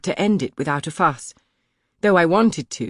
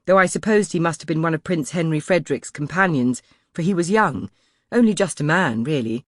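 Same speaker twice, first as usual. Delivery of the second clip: usual speaking voice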